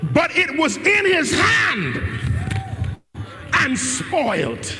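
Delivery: full-force sermon delivery; preaching at full force. Acted. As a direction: hammer each line home to the church.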